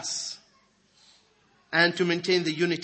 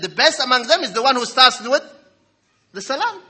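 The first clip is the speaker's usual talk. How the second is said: louder, rising higher on the salam; emphatic quote; 6 semitones higher, 9 dB louder than usual. Acted. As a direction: proclaim it with growing fervour. Quoting the Prophet with emphasis.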